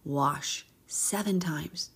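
slow, breathy whisper. Retold or imitated. Host whispering Elisha's instruction to Naaman.

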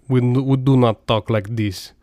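put-on slow, heavy Russian accent; mock Russian accent. Host parodies how Westerners imitate Russians, mock Russian accent. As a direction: imitate a Russian objecting, each word spaced out. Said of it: "you know how Americans and British imitate Russians".

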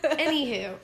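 loud word through real laughter; laughing. Real, not acted. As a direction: burst out laughing on it. Laughing while moving on.

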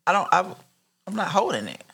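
usual speaking voice

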